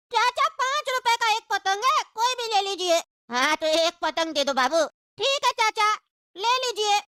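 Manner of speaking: high-pitched sassy street hawker voice; cheeky kite seller. Acted. Annoyed kite seller tells off the passenger, in a high cheeky voice.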